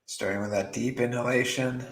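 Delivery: soft, unhurried meditation voice with long pauses; guided meditation. Acted. Meditation teacher guiding beginners to drop worry, soft and calm. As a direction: ease them gently into stillness, softly.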